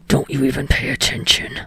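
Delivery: low unvoiced angry whisper; mildly seething low whisper. A narrator's reading. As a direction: seethe it through your breath. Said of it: "he seethes".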